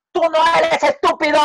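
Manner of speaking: high, loud father's shout; father yelling at his son that he is not stupid. Acted. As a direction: yell it at the boy in frustration.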